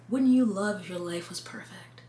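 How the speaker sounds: breathy tempting whisper; whispered sales pitch. Retold or imitated. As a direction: lure them in with a whisper. Podcast pitch voiced seductively.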